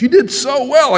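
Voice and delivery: hammed-up doctor praising a patient after surgery; cheery doctor. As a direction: chirp it like a beaming surgeon. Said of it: "My doctor says".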